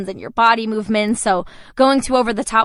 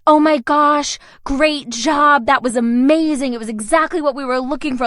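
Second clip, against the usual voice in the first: excited casting director, higher at the start; gushing praise; 4 semitones higher than usual. Retold or imitated. Casting director praising the girl who auditioned, gushing. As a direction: imitate an impressed director gushing over an audition.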